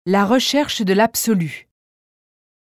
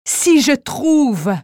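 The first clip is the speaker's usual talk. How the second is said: higher, louder, slow, growing excitement; second rising tone; 6 semitones higher, 4 dB louder, much slower than usual. Acted. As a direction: lift it, the idea taking hold. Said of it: "sur trois tons différents".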